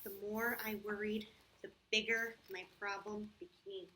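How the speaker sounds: put-on storybook narration for children; story reading. A narrator's reading. Teacher reads the problem story to the kids, storybook voice. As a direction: read it like a picture book aloud.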